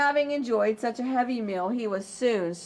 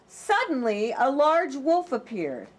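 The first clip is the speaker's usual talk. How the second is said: plain reading voice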